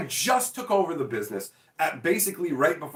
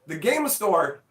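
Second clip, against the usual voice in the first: ordinary delivery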